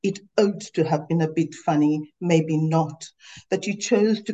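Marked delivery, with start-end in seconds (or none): none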